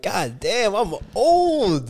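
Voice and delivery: high, loud exaggerated realization; mock dismay. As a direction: wail it in comic horror. Retold shock at own age.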